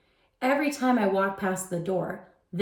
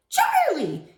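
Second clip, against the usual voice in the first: high, loud cry of his name; first grader yelling for Charlie; 13 semitones higher, 4 dB louder than usual. A narrator's reading. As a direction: yell it though he's close by. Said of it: "He was still yelling".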